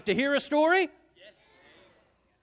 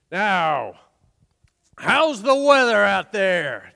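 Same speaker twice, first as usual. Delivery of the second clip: ordinary delivery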